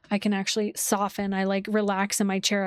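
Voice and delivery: ordinary talk, nothing marked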